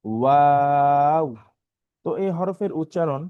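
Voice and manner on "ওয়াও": ওয়াও stretched very slowly and loud; teacher repeats ওয়াও slowly; drawn-out letter name. Shown as a demonstration. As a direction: hold ওয়াও long, lips rounded. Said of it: "আবারও বলছি আমি".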